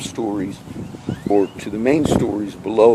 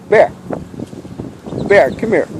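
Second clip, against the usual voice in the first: calling the dog; 9 semitones higher than usual. Real, not acted. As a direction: call the dog back across the yard.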